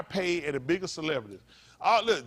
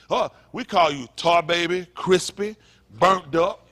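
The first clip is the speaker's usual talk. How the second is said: mock taunting; 4 semitones higher, 8 dB louder, slower than usual. Acting out taunts people taught.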